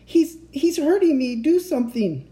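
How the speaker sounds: high, stammering plea; high-pitched panicked cry; desperate cry. A narrator's reading. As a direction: plead urgently for help. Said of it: "I cried".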